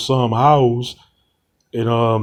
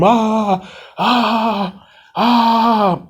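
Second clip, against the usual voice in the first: staged, pitched-up ah ah ah; mock scream; 9 semitones higher, much slower than usual. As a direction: act out a scream as she bites. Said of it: "And I scream".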